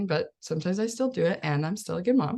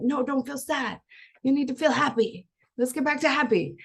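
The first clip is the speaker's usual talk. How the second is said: higher, bright insistence, lightly imitated; cheery pushy mom; 7 semitones higher than usual. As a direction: nudge the sad child toward happy. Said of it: "we're like".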